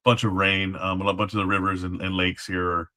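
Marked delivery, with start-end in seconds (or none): none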